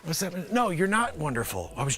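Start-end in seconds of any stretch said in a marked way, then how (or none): none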